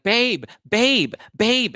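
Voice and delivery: high, far whining for her man; mock whiny calling. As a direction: squeal babe like a drunk girlfriend. Drunk woman calling boyfriend.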